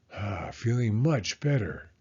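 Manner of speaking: ordinary delivery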